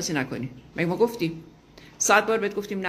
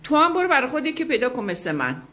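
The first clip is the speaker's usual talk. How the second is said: shameless retort; 6 semitones higher than usual. Retold or imitated. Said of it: "با کمال وقاحت هم برگشته به من میگه".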